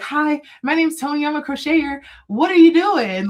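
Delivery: bright, high and eager, rising loud at the end; super enthusiastic. Retold or imitated. Young crocheter rushing up to a stranger, high excited greeting. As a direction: bounce up to her bursting with excitement. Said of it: "really enthusiastic".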